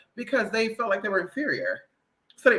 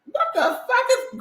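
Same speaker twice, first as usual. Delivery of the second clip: laughing disbelief; slower than usual. Retold or imitated. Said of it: "I was like".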